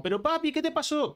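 high-pitched put-on child voice; concerned little son. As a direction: ask like a worried kid. Voices son finding father upset.

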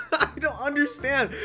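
high, helpless laughter through the words; too tickled to get the sentence out; laughing bafflement. Real, not acted. Streamer called mean by a trader, laughing and baffled.

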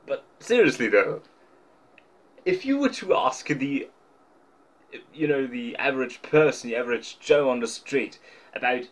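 normal talking voice

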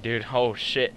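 ordinary talk

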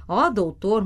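plain reading voice

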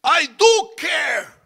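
high shouted snap; angry shout. Pretend. Jesus snapping back at the yelling disciples, angry shout. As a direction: yell at them to shut up. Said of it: "You know what I'm saying".

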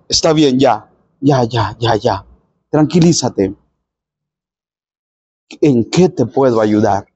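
low, softened calming voice; soothing reassurance. Retold or imitated. Jesus to the blind man, calm and reassuring. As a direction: gently settle someone who has been calling out.

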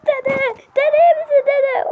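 high, loud cry for grandpa; panicked cry. Acted. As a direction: call out to a hurt grandfather in panic. Grandchild sees grandfather shot.

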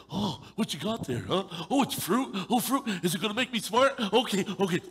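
a man easily tempted, eager; eager dumb guy. Retold or imitated. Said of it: "We're just like".

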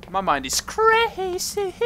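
high, slow, hammed-up crazy voice; mock crazy voice. Gamer jokes about his crazy mind, hammed-up high voice. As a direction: stretch it out like a madman.

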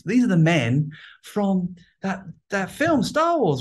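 put-on high, eager child calling his mum; excited little kid. Boy tugging his mum in the toy shop, high and excited. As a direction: squeal like a boy who just spotted toys. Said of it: "I said to my mom".

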